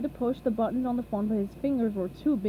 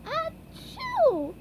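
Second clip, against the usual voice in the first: sneeze. A narrator's reading. Sick SpongeBob sneezes.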